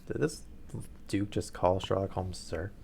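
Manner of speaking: ordinary delivery, nothing marked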